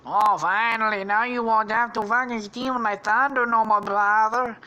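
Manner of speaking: high, loud put-on voice; Luigi. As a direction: gloat loudly as a cartoon villain. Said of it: "reveals it to be Luigi".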